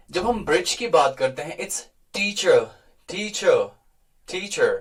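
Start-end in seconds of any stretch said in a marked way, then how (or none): 1.6-4.7 "teacher" in an American accent with a firm t and a clear r; slow American "teacher", sounding the r; American teacher demo, firm t. Shown as a demonstration. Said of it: "ट पे थोड़ा सा stress है और आर भी".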